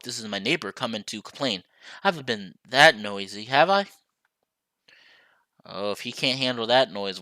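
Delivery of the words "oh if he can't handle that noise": usual speaking voice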